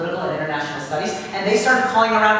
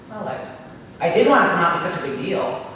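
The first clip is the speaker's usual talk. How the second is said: usual speaking voice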